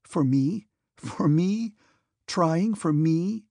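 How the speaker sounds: rising, slowed disbelief on trying; surprised, aghast. Acted. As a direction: repeat it, stunned it could be asked. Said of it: "first surprised and then aghast".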